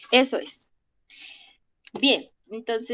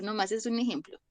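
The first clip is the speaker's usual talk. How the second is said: ordinary talk, nothing marked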